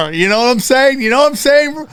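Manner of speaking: high, giggly needling; laughing tease. Real, not acted. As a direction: laugh it out at Ryan. Teasing friend, laughing.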